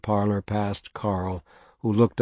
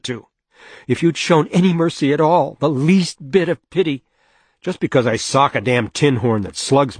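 higher, louder, rising fury; furious outburst; 4 semitones higher, 5 dB louder than usual. Acted. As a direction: let the anger pour out at him.

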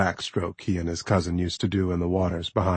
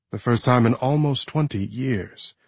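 ordinary delivery

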